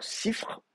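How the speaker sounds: Sifr soufflé, deux consonnes finales; forme fautive chuchotée. Shown as a demonstration. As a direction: murmurer Sifr pour montrer la faute. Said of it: "on n'aime pas ça en fin de mot". (Sifr, chuchoté sans voix)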